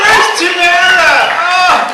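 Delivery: loud, high call across the room; hollering for Tamara from the stage. Real, not acted. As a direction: shout out to find her in the crowd. Calling out for Tamara.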